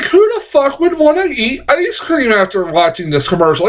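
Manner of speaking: high, stunned outburst; incredulous. Retold or imitated. Reviewer recalling his shock at the ad, high incredulous. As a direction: blurt it out in disbelief. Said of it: "and then I'm like".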